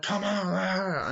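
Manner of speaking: high, drawn-out put-on cry; hammed-up slow wail; mock rampaging yell. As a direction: stretch it out high, imitating a wild biker. Acting out rampaging bikers.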